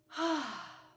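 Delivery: long settling sigh, pitch dropping; audible sigh. Real, not acted. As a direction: let out a big sigh. Settling in before guided meditation.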